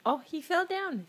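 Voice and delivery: high, loud playful "oh no"; mock surprise. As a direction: gasp it like a toy disaster. Playing toy cars with child.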